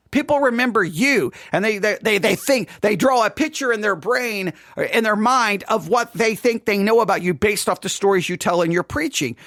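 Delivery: usual speaking voice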